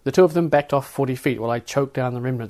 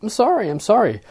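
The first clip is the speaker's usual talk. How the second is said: a hint of a nervous, higher apology; stammered apology; 10 semitones higher, 7 dB louder than usual. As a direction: narrator apologises to the guards, a little nervous. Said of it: "I stammered".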